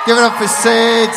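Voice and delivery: loud, high call to cheer; rally the room for Sid; big announcer-style shout to the crowd; hype shout. Real, not acted. Calling crowd to applaud Sid.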